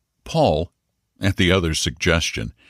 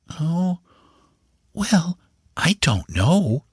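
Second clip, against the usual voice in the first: put-on slow, hesitant boy's voice; uneasy; 11 semitones higher, much slower than usual. A narrator's reading. Boy dodges his uncle's question, slow and uneasy. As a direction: drag the words out, unsure. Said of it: "said the boy uneasily".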